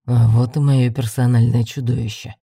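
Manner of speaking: ordinary delivery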